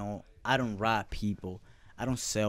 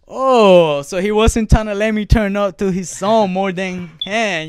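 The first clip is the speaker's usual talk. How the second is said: retold realization; 10 semitones higher, 13 dB louder than usual. Putting two and two together about the rapper, retold loud realization. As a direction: re-enact the penny dropping, loud and high. Acts out his sudden realization.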